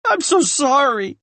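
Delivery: high, pleading, breaking with grief; sobbing apology. A narrator's reading. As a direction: beg forgiveness through tears.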